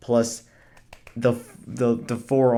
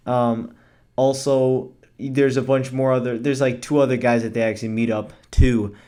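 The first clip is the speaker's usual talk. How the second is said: ordinary delivery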